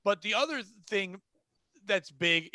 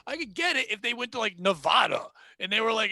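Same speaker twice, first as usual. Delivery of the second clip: usual speaking voice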